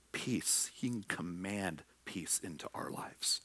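breathy intimate whisper. Real, not acted. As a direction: hush it like a comfort to the listener. Gentle promise of peace.

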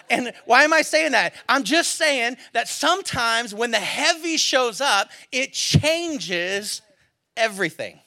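loud, high preaching cadence; preaching at full force. Acted. Pastor driving home the story's point, full-force preaching. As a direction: preach it hard to the room.

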